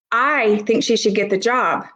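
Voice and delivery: "I" stretched and stressed at the start. Shown as a demonstration. Teacher showing how stress changes meaning, emphasis on "I". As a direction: lean hard on the first word, "I". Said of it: "even the stress of words".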